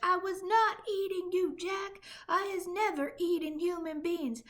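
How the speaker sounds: high, loud desperate denial; pleading terrified giant. A narrator's reading. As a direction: swear innocence in panic.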